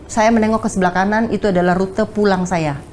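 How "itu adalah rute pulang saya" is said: ordinary talk, nothing marked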